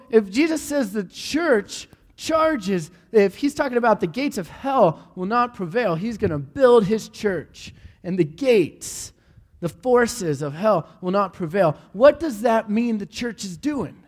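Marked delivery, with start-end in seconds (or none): none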